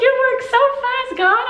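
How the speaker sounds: very high squeal of amazement; freaking out. Retold or imitated. Telling God how fast the prayer was answered, freaking out. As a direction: exclaim it high, giddy with disbelief. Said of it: "freaking out".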